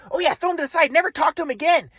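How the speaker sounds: mock parents. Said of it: "interpreted that as".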